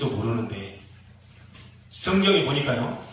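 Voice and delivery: usual speaking voice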